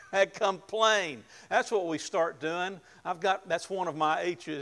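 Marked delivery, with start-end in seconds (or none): 0.1-1.2 amused punchline. Real, not acted. Laughing punchline on hungry people.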